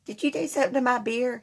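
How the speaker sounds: mocking the drunk ex's high squeaky question; squeaky little girl voice. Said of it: "He kinda squeaked like a little girl".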